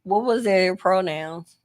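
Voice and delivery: ordinary talk, nothing marked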